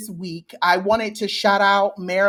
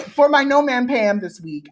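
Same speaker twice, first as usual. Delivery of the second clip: ordinary talk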